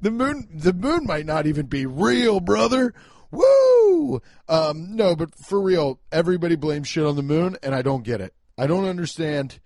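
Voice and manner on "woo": whoop, much higher and louder. Real, not acted. Host cheers his own crazy moon theory with a loud whoop. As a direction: let out a wild woo.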